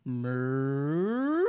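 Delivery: crazed cow moo; mad cow moo. Retold or imitated. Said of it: "then you got the mad cow".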